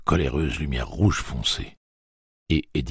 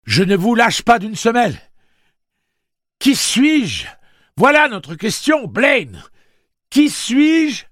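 devinette criée; 11 dB louder, slower than usual. A narrator's reading. Eddie crie la devinette.

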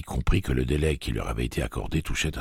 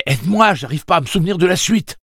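supplique angoissée; 10 dB louder than usual. A narrator's reading. Susanna supplie Eddie.

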